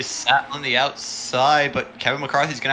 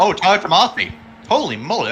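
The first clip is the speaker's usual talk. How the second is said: sudden excited outburst; startled gasp at a near miss; surprised; 5 semitones higher, 3 dB louder than usual. Real, not acted.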